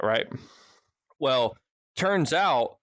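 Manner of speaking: ordinary delivery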